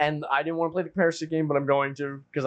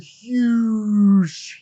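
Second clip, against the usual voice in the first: HUGE drawn out long and loud; emphatic stretched huge; 5 semitones higher, 7 dB louder than usual. Real, not acted. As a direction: stretch HUGE way out, swelling louder. Stresses how big Galactus is.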